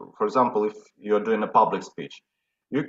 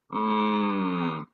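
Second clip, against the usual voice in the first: low steady hum; demo humming; 3 semitones lower, 4 dB quieter than usual. Shown as a demonstration. Voice coach demonstrating a hum. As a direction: hum a long relaxed mm. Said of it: "you can do a simple sound like this".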